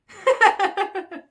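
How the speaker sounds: very loud, high burst of laughter; sudden ringing cackle; big laugh. Real, not acted. Laughs about taking a nap.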